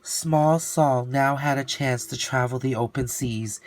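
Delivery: usual speaking voice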